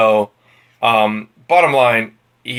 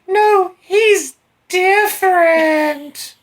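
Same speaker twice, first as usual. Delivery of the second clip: much higher, very slow dreamy swoon; lovestruck girl; much slower than usual. Retold or imitated. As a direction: swoon it like a naive girl in love. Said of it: "And she's like".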